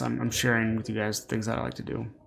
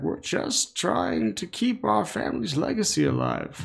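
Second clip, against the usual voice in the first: put-on pleading old owner; 8 semitones higher than usual. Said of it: "his wife are like".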